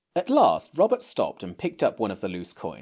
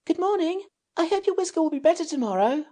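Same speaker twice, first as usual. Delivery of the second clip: put-on higher girl's voice, quick and polite; timid shout; faster than usual. Anthea calls back to the fairy, quick and timid. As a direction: call out a shy goodbye, fast. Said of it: "to shout a timid".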